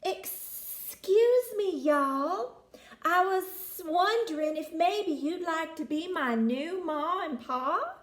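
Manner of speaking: a shy snake asking kindly, slowed right down; polite hissing snake. Acted. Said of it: "Danny hissed".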